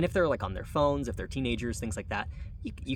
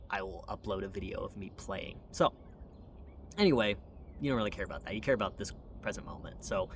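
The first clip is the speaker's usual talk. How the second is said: usual speaking voice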